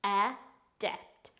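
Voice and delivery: "adept" slowly, split as a, dept; slow teaching demo of "adept"; syllable by syllable. Shown as a demonstration. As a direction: sound out "adept" with a gap after a. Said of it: "It's pronounced".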